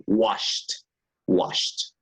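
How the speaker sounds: "washed" with a single final t sound, no extra syllable; slow model word. Shown as a demonstration. Teacher modelling "washed" for the class. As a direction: say "washed" as one syllable ending in t. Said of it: "I'm writing the pronunciation".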